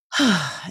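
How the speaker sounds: audible sighed-out breath; one short calming sigh; exhale. Shown as a demonstration. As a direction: let a breath out to settle yourself. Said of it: "I'll take an exhale".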